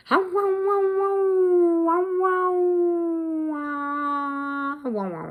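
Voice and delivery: long high wailing howl sliding down; imitated dog howl. Dog left alone calling for owner, mournful howl. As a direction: howl "wow wow" like a lonely dog. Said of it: "he doesn't really bark".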